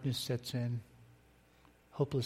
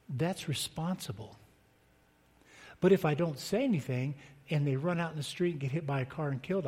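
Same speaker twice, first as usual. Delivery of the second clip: ordinary talk, nothing marked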